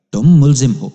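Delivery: narrator's loud accusation. A narrator's reading. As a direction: call out the charge for the village to hear. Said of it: "میں نے اتنی اونچی آواز میں کہا".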